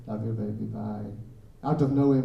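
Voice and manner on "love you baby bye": soft goodbye. Retold or imitated. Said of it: "I was like".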